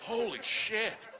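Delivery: slow, high, laughing disbelief; stunned awe. Retold or imitated. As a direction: drag it out, amazed and laughing. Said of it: "I'm like".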